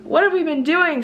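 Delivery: high-pitched, incredulous self-scolding; mock dismay. Recalling her restaurant bill to viewers, high-pitched mock dismay. Retells her shock at the spending.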